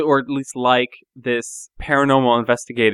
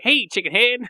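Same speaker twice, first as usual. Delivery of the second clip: put-on Southern accent; 10 semitones higher than usual. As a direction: mimic a Southern gamer buddy's greeting. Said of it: "in this little Southern accent".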